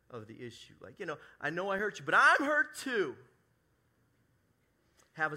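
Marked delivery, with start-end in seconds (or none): 1.4-3.2 mock the self-centred apology, whining it up; hammed-up wounded whine; whiny self-pity. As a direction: voice jumps high and loud on hurt too, slowing down.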